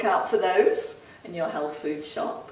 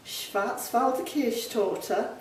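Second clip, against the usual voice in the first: ordinary talk, nothing marked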